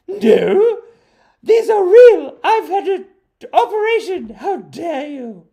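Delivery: high, loud, indignant falsetto; hammed-up outrage in a squeaky voice; mock offended woman. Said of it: "And then she goes".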